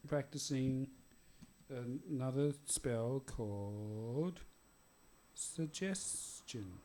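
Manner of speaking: slow, halting reluctant admission; hesitant, drawn-out confession. Acted. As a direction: drag it out as if unwilling to admit it. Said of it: "so Victor says".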